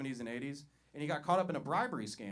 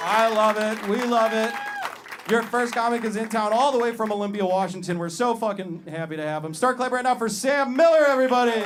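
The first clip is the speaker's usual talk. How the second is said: loud MC intro; 7 semitones higher, 13 dB louder than usual. Real, not acted. MC introducing comic.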